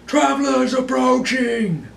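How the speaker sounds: high, loud, drawn-out lookout's cry; shouted call. Acted. Lookout announcing travelers from above, shouted call. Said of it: "uh, a voice saying".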